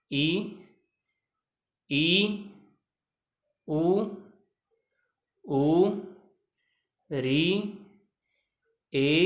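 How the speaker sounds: each vowel आ, ई, उ, ए, ओ, औ said twice or once, slow and clear with a pause after each; reciting the vowels. Shown as a demonstration. Teacher reciting the eleven vowels for students, slow and clear. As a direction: call out every vowel from आ to औ as a slow, separate sound. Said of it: "ग्यारह स्वर का वाचन करते हैं".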